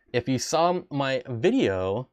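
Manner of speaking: usual speaking voice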